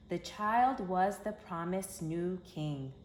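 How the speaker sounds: ordinary delivery, nothing marked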